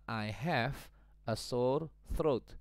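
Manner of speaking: I have a sore throat, slow and careful; slow model sentence. Shown as a demonstration. Teacher modelling the English sentence, slow and clear. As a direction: read the example out clearly for learners. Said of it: "Bahasa Inggrisnya adalah".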